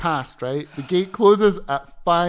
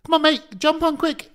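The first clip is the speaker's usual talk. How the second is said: high, loud hurrying call; gate agent; 12 semitones higher, 6 dB louder than usual. Retold or imitated. A gate agent hurrying a late passenger on, high and loud. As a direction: wave him aboard fast. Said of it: "And the guy goes".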